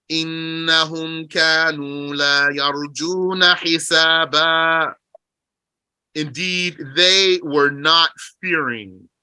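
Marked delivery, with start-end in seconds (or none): 0.1-4.9 measured chanted recitation of the verse; Quran recitation. Acted. Teacher reciting the verse to his class. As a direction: recite it like scripture.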